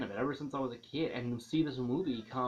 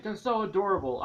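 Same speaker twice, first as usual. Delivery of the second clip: gushing; 8 semitones higher, 8 dB louder than usual. Real, not acted. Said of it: "Yes, I love cute things".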